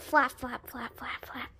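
low, unvoiced repeated flaps; whispered flapping. Bird told to flap along, whispering. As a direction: whisper it in a quick even patter.

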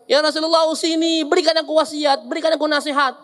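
high, insistent plea; companion's earnest request. Acted. Companion asking the Prophet for advice, pleading.

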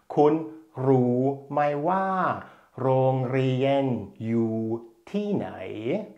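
"Kun Ru Mai Wa, Rongrien, Ju, Tinai" slowly, fading at the end; teacher models the whole Thai sentence slowly. Shown as a demonstration. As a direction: read the whole Thai question slowly, syllable by syllable. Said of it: "Der Satz heißt also".

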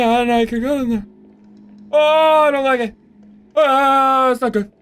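high, loud worried cries; panicked. Real, not acted. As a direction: fret in rising panic. Panicking at enemy.

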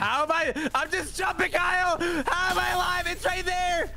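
very high, incredulous outburst; high-pitched disbelief. Real, not acted. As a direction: squeal it in sheer disbelief. Amazed to survive the game.

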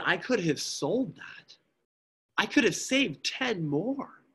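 tearful rising anguish; anguished weeping. Acted. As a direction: cry out in regret. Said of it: "he begins to weep".

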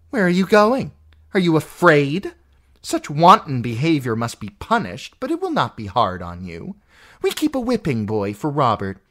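an aunt threatening punishment; cruel aunt. Acted. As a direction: menace her with false sweetness. Lysa threatening Sansa.

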